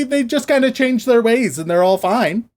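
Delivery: ordinary delivery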